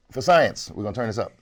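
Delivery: ordinary talk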